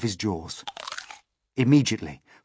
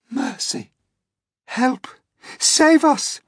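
high cries for help, growing louder; frightened cries; 14 semitones higher, 5 dB louder, slower than usual. Acted. Ladybird begs to be saved, crying out. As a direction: plead in mounting panic. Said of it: "cried the ladybird".

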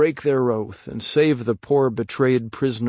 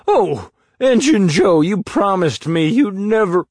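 high, loud panicked cry; accused man crying out to Injun Joe; desperate exclamation; 8 semitones higher, 6 dB louder than usual. Acted. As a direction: plead with the one who betrayed you.

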